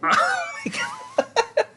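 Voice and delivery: laughing in surprise; shocked high laugh; startled laugh. Real, not acted. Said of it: "Did I spook you".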